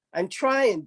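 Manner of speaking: P=A; strained insistence. Acted. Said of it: "Fox performed the spell".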